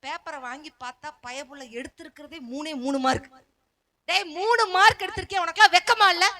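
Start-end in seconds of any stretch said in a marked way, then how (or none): 4.1-6.3 louder mock scolding, higher; scolding teacher. Teacher scolding the boy over his marks, mock stern. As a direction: tell the boy off for three marks.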